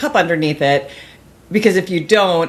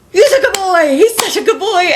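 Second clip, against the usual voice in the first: high, loud doggy-praise voice; gushing dog praise; 11 semitones higher, 4 dB louder than usual. Acted. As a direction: gush over the dog in an excited high pitch.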